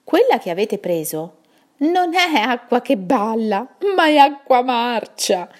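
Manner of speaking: put-on owl voice with a mocking chuckle; chuckling voice from the dark. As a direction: tease them from the shadows as a knowing old owl. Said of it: "ridacchiò una voce dal buio".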